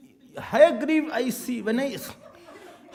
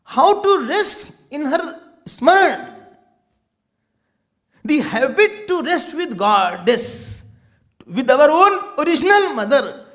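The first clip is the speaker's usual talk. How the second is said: slow, rising devotional appeal; devotional preaching; 5 semitones higher, 3 dB louder, slower than usual. Acted. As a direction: draw them towards the Goddess. Devotional sermon, slowed.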